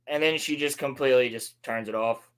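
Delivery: usual speaking voice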